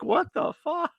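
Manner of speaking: high-pitched, bewildered question; incredulous reaction. Retold or imitated. Viewer reacting to the end scene, incredulous. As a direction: let disbelief push it way up high.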